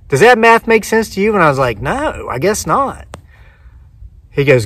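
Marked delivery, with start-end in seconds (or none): 0.1-1.3 high-pitched retold challenge; pointed challenge. Clerk challenges winner on the price, exasperated. 1.8-3.1 higher, softer, slow retold admission; sheepish concession. Winner concedes the clerk's math, sheepish.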